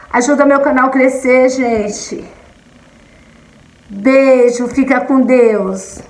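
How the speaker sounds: bright sign-off pitch to subscribers; upbeat vlog outro. Acted. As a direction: sell the subscribe ask sweetly. Vlog sign-off call to action.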